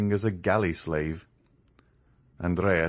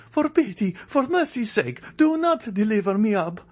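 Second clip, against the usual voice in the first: much higher, louder desperate begging; hand-clasped plea; 4 dB louder than usual. A narrator's reading. Andrea pleads with the ladies to hide him, frantic. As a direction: implore them, hunted and frantic. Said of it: "said Andrea, clasping his hands".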